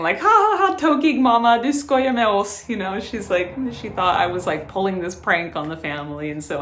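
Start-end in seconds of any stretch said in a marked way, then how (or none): 0.2-2.7 put-on a light child's laugh, high and playful; imitating her daughter. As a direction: mimic a giggling five-year-old in Swedish. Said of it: "my five-year-old was just saying".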